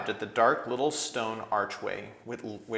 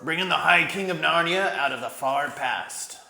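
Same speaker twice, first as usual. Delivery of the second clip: lifted, louder dwarf voice announcing a king; proud dwarf; 7 semitones higher, 9 dB louder than usual. A narrator's reading. Dwarf presenting the High King to the badgers, proud and loud. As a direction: herald him grandly.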